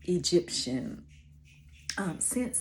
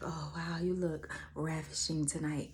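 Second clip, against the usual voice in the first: sultry; 2 semitones lower, 8 dB quieter than usual. Retold or imitated. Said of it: "sultry voice".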